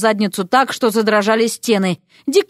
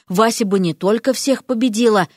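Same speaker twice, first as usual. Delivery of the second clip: usual speaking voice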